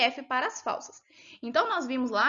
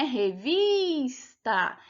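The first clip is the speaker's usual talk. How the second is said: "Revista" very slow, the vis drawn out long; stress on VIS; much slower than usual. Shown as a demonstration. Teacher confirming what we hear when pronouncing, "Revista" with a long stressed vis. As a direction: stretch vis in "Revista", then release the last syllable. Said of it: "percebe que é o vis".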